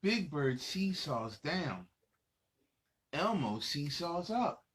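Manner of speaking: put-on lively read-aloud for little kids; reading to children. Adult reading a picture book to young children, lively storytelling. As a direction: read it out bright and animated for the kids.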